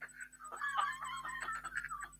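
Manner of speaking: high laugh breaking out after the act; real laugh after holding a straight face; burst of laughter. Real, not acted. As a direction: laugh you could not hold in. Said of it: "I couldn't keep a straight face through that scene".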